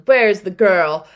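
loud, drawn-out mock demand; retold royal anger, loud and slow; angry king. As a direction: demand it slowly and loudly as an imitated angry ruler. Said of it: "gets angry and goes".

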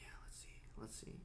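whispered under the breath; whispering. Real, not acted. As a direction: whisper while the call rings. Waiting for the call.

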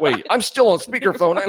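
loud, high-pitched mock alarm; comically affronted caller; indignant realization. Acted caller interrupts his creepy speech.

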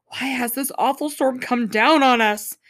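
mildly dramatized demand. A narrator's reading. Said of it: "they demanded".

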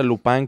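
Lupin said French-style as "lu-PAN"; pronunciación francesa. Shown as a demonstration. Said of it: "no se pronuncia". (Lupin como en francés, «lupán»)

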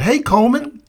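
high greeting with a rising end; friendly sergeant. Retold or imitated. Recruiter repeats his greeting to Coleman, high and rising. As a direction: call his name warmly, lifting at the end.

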